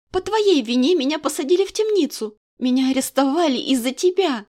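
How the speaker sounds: shouting blame at the king; angry accusation. Acted. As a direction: jab each word at him.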